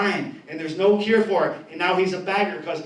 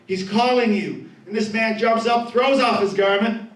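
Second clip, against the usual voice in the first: ordinary delivery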